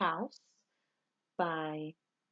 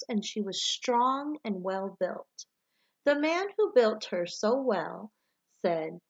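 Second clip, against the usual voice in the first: put-on reading a picture book to children, bright and even; gentle read-aloud storyteller voice; story narration; 3 semitones higher, 4 dB louder than usual. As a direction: tell it like story time for the class. Teacher reads storybook aloud.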